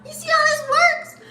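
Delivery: high, excited mock squeal; playful squeal. Hyping up her own point.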